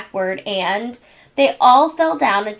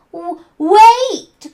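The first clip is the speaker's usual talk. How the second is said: much higher, louder stammered cry; cried out; 10 semitones higher, 10 dB louder than usual. A narrator's reading. Chucky calling after Angelica, panicked high cry. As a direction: call after her in a panic. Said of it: "cried Chucky".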